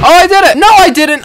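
high, loud outburst; excited shout. Real, not acted. Gamer thinking they made it, shouting excitedly. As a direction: shout high in triumph, then straight away in dismay. Triumph turns to failure.

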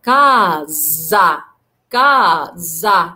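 كازا said slowly with the s as z, ka-za; teacher models كازا with z; s voiced as z. Shown as a demonstration. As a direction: draw out كازا with a clear z sound. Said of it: "بتنطق ز".

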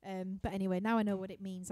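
ordinary talk, nothing marked

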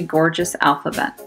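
ordinary delivery

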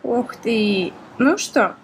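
ordinary talk

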